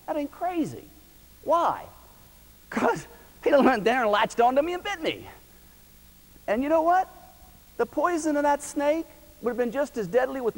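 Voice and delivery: high, loud pulpit preaching; fiery revival sermon; full-force preaching. Acted. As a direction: drive it home to the congregation. Preaching about the snake kit.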